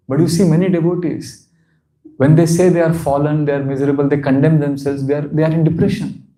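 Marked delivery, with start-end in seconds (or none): none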